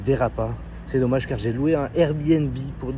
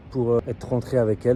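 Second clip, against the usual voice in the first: ordinary delivery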